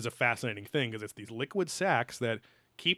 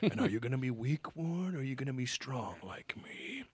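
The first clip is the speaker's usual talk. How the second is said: hushed, menacing villain whisper; 6 dB quieter than usual. Retold or imitated. Villain whispering a threat to Ward, hushed.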